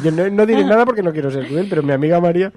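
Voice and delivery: mocking parody. Teasing his co-host by parroting her. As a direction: tease her by parroting her line. Mocking friend's cagey phrasing.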